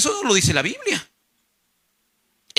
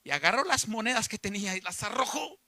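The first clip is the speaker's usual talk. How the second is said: ordinary talk, nothing marked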